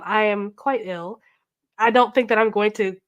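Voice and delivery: ordinary delivery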